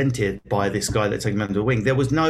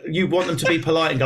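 ordinary talk

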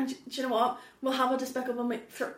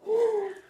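sharp, high, mock-shocked gasp; 9 semitones higher than usual. A theatrical gasp at her co-host's cheeky question.

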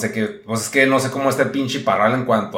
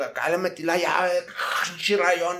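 usual speaking voice

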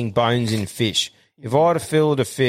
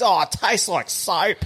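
mock disgusted wife; 8 semitones higher than usual. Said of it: "She goes".

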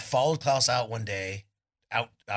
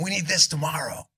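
put-on higher, eager kid pleading for gear; retold eager kid; 7 semitones higher, 4 dB louder than usual. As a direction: pitch it up like a greedy teen. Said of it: "I'm like".

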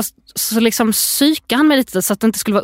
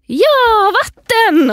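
put-on very high, loud gleeful child shout; imitated toddler; 13 semitones higher, 5 dB louder, much slower than usual. Mother imitating son with glass of water, high loud exclamation. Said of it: "och så säger han".

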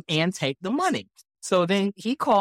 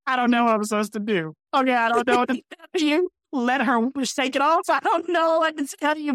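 usual speaking voice

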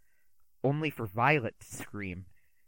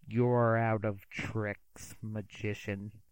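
cold rage; 2 semitones lower, much slower than usual. Acted. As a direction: threaten him slowly and coldly. Grabs magician, gun to head.

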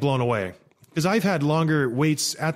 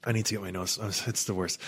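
out of breath; 7 semitones lower, 10 dB quieter than usual. Real, not acted. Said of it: "Hold on, I gotta breathe".

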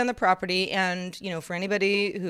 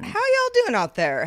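excited announcer greeting; 13 semitones higher, 3 dB louder than usual. Acted. Hyped greeting to audience.

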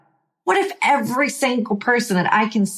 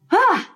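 relieved ah; 13 semitones higher than usual. Shown as a demonstration. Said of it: "and smile".

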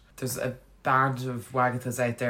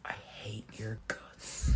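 laughing half-whisper; 4 semitones lower, 11 dB quieter, much slower than usual. Retold or imitated. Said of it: "she was like".

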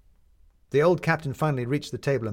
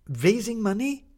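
incredulous; 11 semitones higher, 5 dB louder, slower than usual. Acted. Maddie incredulous echo.

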